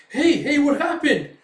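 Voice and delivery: loud, high, urgent question; alarmed calling out. Retold or imitated. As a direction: cry out in sudden alarm. Reacting to Puck freaking out.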